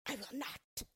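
mildly venomous witch voice; hissed witch refusal. A narrator's reading. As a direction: refuse with a touch of witchy hiss. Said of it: "hissed the witch".

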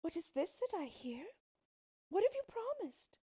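very high, distressed questioning; weeping queen. Acted. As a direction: plead tearfully as an anguished queen. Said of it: "said the queen, weeping".

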